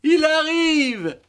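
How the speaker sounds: high, drawn-out call on a staircase; calling out announcement. Acted. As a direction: sing out the news, very high and slow. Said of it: "dit quelqu'un sur l'escalier". (appel aigu et traînant)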